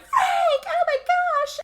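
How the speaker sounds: very high squeal of alarm; imitated panic, shooting way up in pitch; mock panicked cry. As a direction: cry out like a hammed-up horror victim. Retold victim cries for husband.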